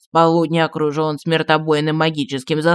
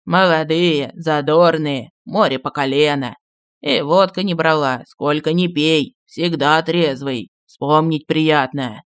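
slow, lower, fond reminiscing; nostalgic; 3 semitones lower, slower than usual. A narrator's reading. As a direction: savour the good old days. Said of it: "с ностальгией по ушедшей молодости".

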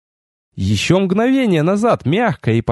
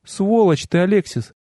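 higher, subdued female character; reproachful goddess; 6 semitones higher than usual. A narrator's reading. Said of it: "констатировала Ашарис". (высокий женский голос с укором)

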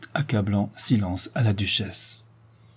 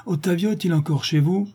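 high, questioning call; husband calling in from outside; 8 semitones higher than usual. A narrator's reading. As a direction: enquire through a closed door. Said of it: "disait en dehors la voix du duc".

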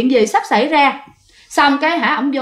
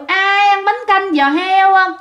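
high, stretched-out hawker's chant; walking vendor's cry; 7 semitones higher, slower than usual. A narrator's reading. One-legged ghost hawking noodle soup as it walks, high drawn-out vendor cry. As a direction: call your wares in a slow, high sing-song. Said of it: "vừa đi vừa kêu".

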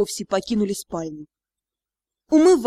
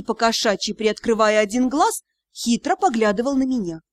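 ordinary delivery

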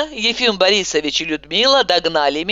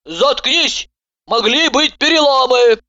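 bark; 7 semitones higher than usual. A narrator's reading. Doctor barks at her ranting patient. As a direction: bark the order sharply. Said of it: "она рявкнула".

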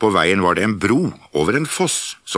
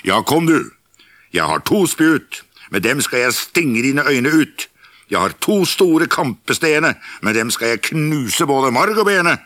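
higher and louder, defiant; bold loud challenge; 8 semitones higher, 4 dB louder than usual. Acted. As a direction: shout down the troll. Big goat threatens the troll.

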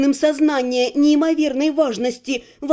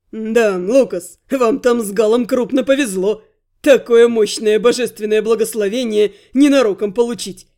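mocking thief; 4 semitones lower than usual. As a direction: tease them with a smirk. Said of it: "глумливо захихикал вор".

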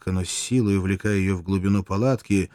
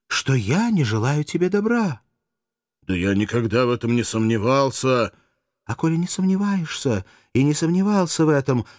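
reproachful; 8 semitones higher, 3 dB louder than usual. Acted. Courtesan reproaches coaxingly.

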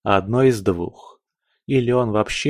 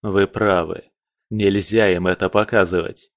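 ordinary delivery

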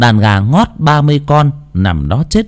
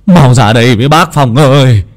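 urgent calling; 3 semitones higher, 6 dB louder than usual. Acted.

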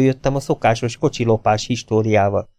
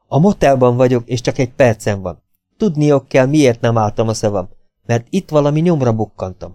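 ordinary delivery

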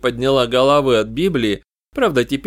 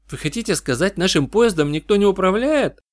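surprised; 7 semitones higher than usual. Acted. Janna shocked by driverless train.

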